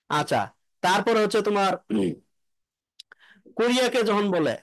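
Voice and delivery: usual speaking voice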